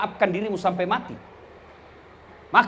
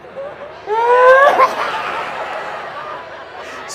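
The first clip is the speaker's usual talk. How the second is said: high cackling laugh; 13 semitones higher than usual. Real, not acted. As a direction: crack up at your own joke. Preacher laughs at the joke.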